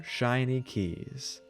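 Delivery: ordinary delivery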